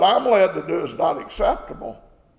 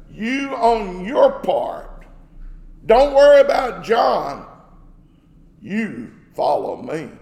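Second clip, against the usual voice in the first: slow, weighty command, louder on "don't worry about John"; very emphatic; 2 semitones higher, 5 dB louder, slower than usual. Retold or imitated. Jesus answering Peter's worry about John, very emphatic. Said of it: "Very emphatic".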